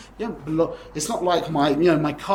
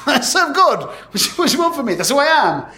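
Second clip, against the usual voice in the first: incredulous, rising to a laughing, much higher, louder outburst; exasperated retort; 5 semitones higher, 4 dB louder than usual. Retold or imitated. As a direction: brush off a silly question, laughing loudly.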